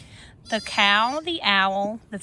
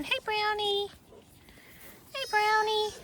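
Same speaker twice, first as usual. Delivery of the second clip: cooing to an animal; 10 semitones higher, 6 dB quieter than usual. Real, not acted. Greeting a goat.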